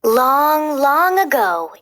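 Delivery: plain reading voice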